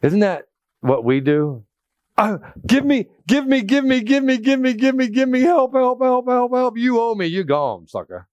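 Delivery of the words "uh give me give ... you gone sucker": high, rapid chant of "give me"; mock a greedy grabber, then sneer; hammed-up greedy creditor mocking the debtor; mock greedy grabbing.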